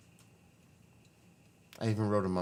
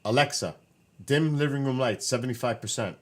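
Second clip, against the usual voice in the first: "dim" said louder and higher, spelled out for the device; louder repeated command; 4 semitones higher, 6 dB louder than usual. Real, not acted. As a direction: repeat it so Alexa finally hears.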